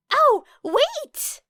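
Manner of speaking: very high, loud sudden cry; exclaiming Ashido. A narrator's reading. Ashido suddenly remembering, high loud exclaim. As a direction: pop up with a new idea. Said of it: "exclaimed Ashido".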